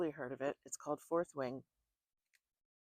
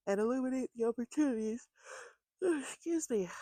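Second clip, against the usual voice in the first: yawning through the words; words stretched by a real yawn; 9 semitones higher, 8 dB louder than usual. Real, not acted. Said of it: "as I yawn my way through".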